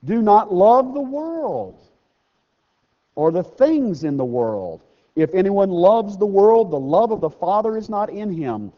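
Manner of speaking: measured preacher's scripture read. Acted. Reading scripture aloud.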